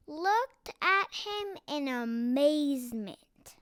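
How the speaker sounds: usual speaking voice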